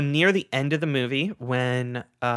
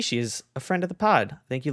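normal talking voice

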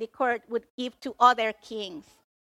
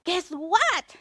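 high-pitched teasing announcement; reading to kids, attention-grabbing; 6 semitones higher than usual. Shown as a demonstration. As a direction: grab the children's attention with big news. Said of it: "reading to the kids and you want to get the attention".

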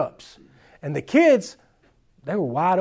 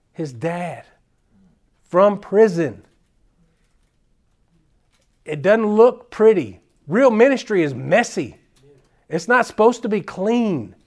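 rising sermon fervor from the pulpit; preaching; 3 semitones higher, 4 dB louder than usual. Real, not acted. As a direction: drive it home to the congregation. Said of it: "I'm gonna start preaching again".

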